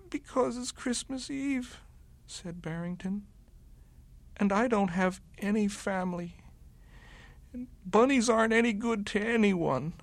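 high bunny voice, forlorn; sad little bunny. A narrator's reading. Bunny telling the wolf he has no family, high and sad. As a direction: plead like a lonely small bunny.

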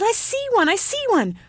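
put-on high-pitched, thrilled child; excited cry. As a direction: squeal it out in excitement. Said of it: "cried the ugly child".

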